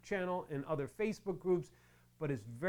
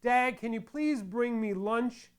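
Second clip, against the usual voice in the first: pleading daughter; 7 semitones higher, 6 dB louder, slower than usual. Retold or imitated. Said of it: "my daughter".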